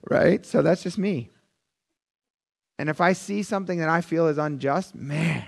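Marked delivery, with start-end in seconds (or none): none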